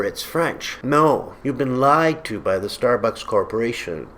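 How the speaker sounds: usual speaking voice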